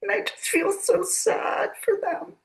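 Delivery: high, near tears, holding it together; voice breaking. Real, not acted. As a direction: let the voice crack with real grief. Grieving over her parents.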